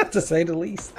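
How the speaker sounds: laughing. Real, not acted. Relieved laugh after close fight.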